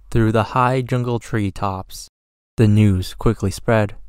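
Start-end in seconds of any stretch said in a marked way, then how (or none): none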